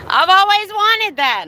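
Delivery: high, loud mock-dreamy gush. To Stoner Guy on stream, playful mock gushing. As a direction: gush it like a teen fan.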